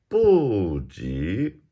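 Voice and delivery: "部" falling from high, "级" rising; fourth then second tone. Shown as a demonstration. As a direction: drop "部" long, then swoop "级" upward. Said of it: "fourth, second".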